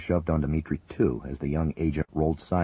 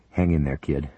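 plain reading voice

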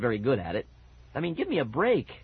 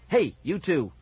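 loud, higher shout of authority; stern, raised voice; stern call; 4 semitones higher, 5 dB louder than usual. A narrator's reading. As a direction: call out to scold two intruders. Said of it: "a stern voice called".

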